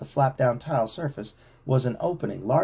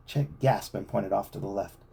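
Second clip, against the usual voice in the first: usual speaking voice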